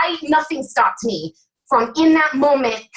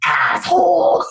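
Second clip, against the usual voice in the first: a quick shouted mock insult; half-joking exasperated jab; mock blunt insult; 5 semitones higher, slower than usual. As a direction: fire it off like an exasperated aside. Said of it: "And I'm like".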